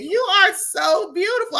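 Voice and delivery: high, loud and thrilled; gushing excited shout. Real, not acted. Host greeting her guest, gushing, excited and loud. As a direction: burst out with delight at her.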